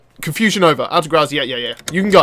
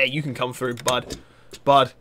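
ordinary delivery

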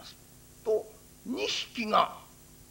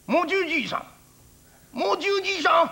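calling out; 7 semitones higher, 4 dB louder, faster than usual. Acted. Priest calls out to old man.

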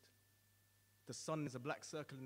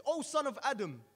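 higher, louder menacing call; threatening roar; 9 semitones higher, 11 dB louder than usual. Acted. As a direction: roar the name down at him. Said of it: "responds with a threatening roar".

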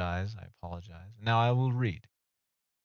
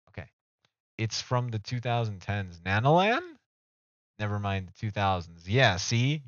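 normal talking voice

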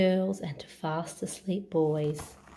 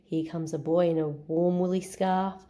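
ordinary delivery

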